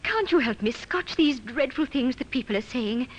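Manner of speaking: ordinary talk, nothing marked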